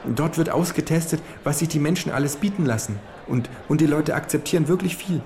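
high falsetto of a small fat lobbyist. Acted. Lobbyist lecturing the kangaroo at the buffet, high falsetto. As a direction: squeak it out like a nervous little fat man. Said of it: "mit Fistelstimme".

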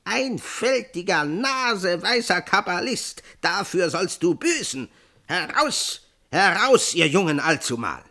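high, loud, raging cry; furious goblin shout. A narrator's reading. Goblin king yelling at Dapsul, furious shout. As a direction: bellow it at the foolish cabbalist. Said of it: "indem er rief".

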